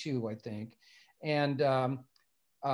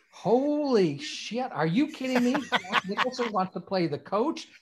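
stunned disbelief; 7 semitones higher than usual. Retold or imitated. Friend retells hearing a star wants the part, incredulous. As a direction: stretch the opening oath, stay high-pitched and astonished.

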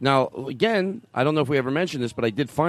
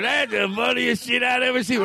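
laughing islander; 10 semitones higher than usual. Retold or imitated. Mock islander delight.

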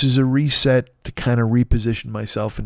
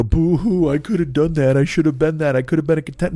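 higher, whiny boo-hoo self-pity, hammed up; mock a guy feeling sorry for himself; mock whining guy; 5 semitones higher than usual. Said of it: "nobody wants to hear a guy go".

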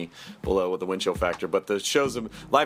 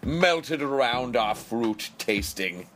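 grand commercial voice; 6 semitones higher, slower than usual. Acted. Voicing the strawberries' slogan.

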